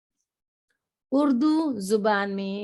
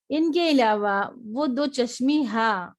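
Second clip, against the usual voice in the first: ordinary delivery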